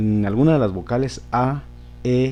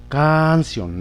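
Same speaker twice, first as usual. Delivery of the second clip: "Canción" with CAN drawn out and raised, ending falling away; stress on first syllable; 4 semitones higher than usual. Shown as a demonstration. Teacher asking if the A takes the accent, stress on CAN in "Canción". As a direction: hold the A of "Canción" long and high. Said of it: "¿Lo llevaría en la A?".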